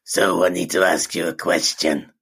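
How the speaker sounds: a low, husky put-on smoker's rasp. Impersonating a chain-smoking wife questioning her husband, husky mock voice. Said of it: "'cause she smokes".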